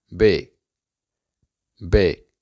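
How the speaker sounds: "B" as "big" with the final g dropped; reduced "big". Shown as a demonstration. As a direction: say "B" short, for "big". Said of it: "se pronuncia B". ("B" sin la g final de "big")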